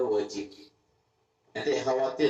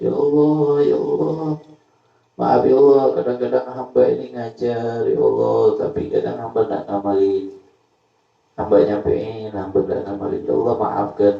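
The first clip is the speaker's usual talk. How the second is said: crying prayer; 2 semitones lower, 9 dB louder than usual. Retold or imitated. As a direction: shout the repentance to God at night. (doa keras memohon ampun)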